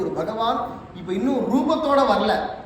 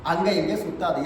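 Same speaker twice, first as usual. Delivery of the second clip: ordinary delivery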